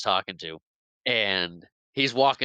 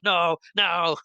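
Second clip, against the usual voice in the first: higher, hammed-up panicked cry; mock freak-out; 6 semitones higher, 4 dB louder than usual. Pirate facing the boo box, mock freak-out. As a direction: imitate a pirate pleading in panic. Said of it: "he starts freaking out".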